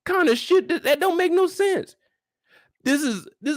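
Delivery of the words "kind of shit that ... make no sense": much higher, squeaky disbelief; incredulous. Acted. Commentator baffled by the invitation, voice much higher. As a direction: shoot up in disbelief.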